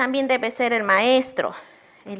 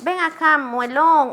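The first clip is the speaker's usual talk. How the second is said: higher, louder taunting call; mocking teacher; 5 semitones higher, 4 dB louder than usual. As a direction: tease a child by a nickname. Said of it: "Eso no debe ser nuestro trato".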